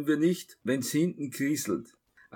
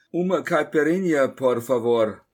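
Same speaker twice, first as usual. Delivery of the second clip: loud call for one drink across the bar; 4 semitones higher, 6 dB louder than usual. Acted. Narrator calls loudly across to the bar for a drink. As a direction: shout the order over to the counter.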